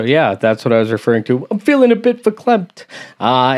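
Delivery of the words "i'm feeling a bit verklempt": "I'm feeling a bit verklempt" lifted high, mock choked-up; a light, raised imitation of the Coffee Talk host; Coffee Talk host impression. Vlogger echoing the SNL clip to viewers, mock verklempt. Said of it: "I'm a little verklempt".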